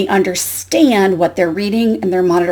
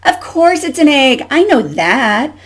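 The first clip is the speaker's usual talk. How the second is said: huffy duck, higher and louder; 6 semitones higher, 4 dB louder than usual. Acted. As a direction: read it like an indignant storybook duck. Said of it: "huffed Duck".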